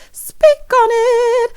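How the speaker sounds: high, loud, stretched-out plea; exaggerated playful demand; hammed-up urgency. Imagined hotline call, hammed up.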